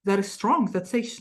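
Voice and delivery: normal talking voice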